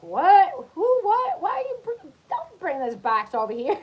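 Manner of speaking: shrill-free high startled yell, rising fast; shocked high yelp. Retold or imitated. Young girl yelping at the creepy old lady. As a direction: jump back in alarm at a stranger's words. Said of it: "She's like".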